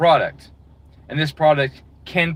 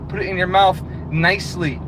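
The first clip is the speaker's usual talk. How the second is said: usual speaking voice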